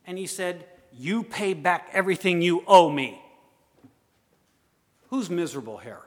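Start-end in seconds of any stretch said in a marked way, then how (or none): 0.9-3.2 loud, raised creditor's demand, building in force; angry demand. Acted. Servant grabs his debtor by the neck, angry demand. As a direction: demand it forcefully from someone you have by the throat.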